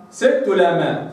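ordinary talk, nothing marked

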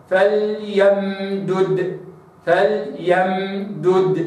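فليمدد drawn out slowly, two dals kept distinct; Quranic recitation. Shown as a demonstration. Teacher models فليمدد twice, slowly. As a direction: recite فليمدد slowly, release the first dal then the second. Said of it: "هكذا".

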